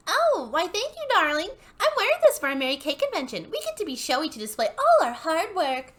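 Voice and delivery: high, loud, gushing hun in a put-on Dallas accent; showy Dallas belle, high and loud; mock Dallas accent. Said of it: "do my standard hun bot".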